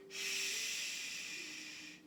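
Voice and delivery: soft unvoiced shh; quiet liver sound demo; whispered shh. Shown as a demonstration. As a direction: let the shh hiss out gently. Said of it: "The sound is".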